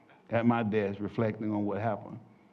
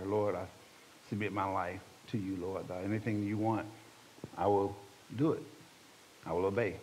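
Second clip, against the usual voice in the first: low, hushed, halting pledge; prayer of surrender; 5 semitones lower, 10 dB quieter than usual. Retold or imitated. Man praying privately during the sermon, low hushed submission. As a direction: pray softly, pausing between promises.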